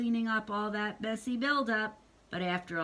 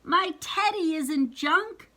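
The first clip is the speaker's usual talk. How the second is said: put-on high, slowed plea of a little girl; a hint of a hurt little sister; lightly voiced wail; mild mock scream; 7 semitones higher, 5 dB louder than usual. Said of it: "screamed sister".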